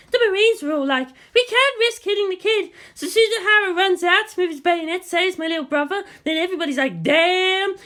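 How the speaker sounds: high, eager schoolboy retelling; lightly voiced excited student. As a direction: rattle it off like a thrilled kid.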